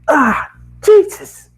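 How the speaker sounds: high, loud, drawn-out cry of pain; startled pained shout. Acted. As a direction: yelp it out after banging the head. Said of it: "I shouted".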